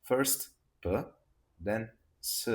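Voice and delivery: first P, then S, each letter set apart; name each letter as its own step; teacher spelling out the order of sounds; slow step-by-step letters. Shown as a demonstration.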